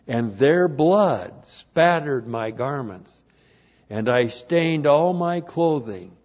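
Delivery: forceful Bible reading, loud on key words; scripture reading. Real, not acted. Preacher reading God's wrath in Isaiah, emphatic declamation. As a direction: proclaim each phrase with weight.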